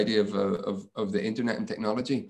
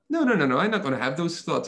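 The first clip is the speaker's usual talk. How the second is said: high, loud burst of refusal; emphatic self-refusal; 6 semitones higher, 3 dB louder than usual. Retold or imitated. Speaker refusing negative thoughts about themselves, emphatic high-pitched refusal. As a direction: firmly talk yourself out of it, starting high.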